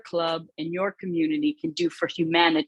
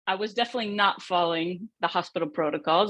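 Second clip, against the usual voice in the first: ordinary delivery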